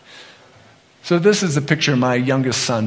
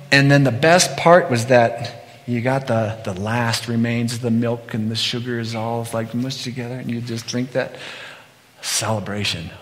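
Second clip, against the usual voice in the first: ordinary talk, nothing marked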